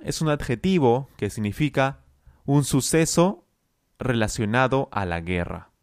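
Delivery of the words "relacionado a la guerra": ordinary delivery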